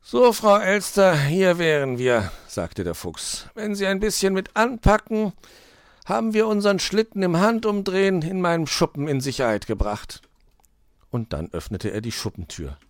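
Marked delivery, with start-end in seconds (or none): 0.0-2.3 loud, high opening sliding down slowly; scheming fox. A narrator's reading. Fox arrives at the shed with magpie, self-satisfied. 3.5-10.2 high, loud fox voice; scheming fox. A narrator's reading. Fox asks magpie to help move the sledge, businesslike.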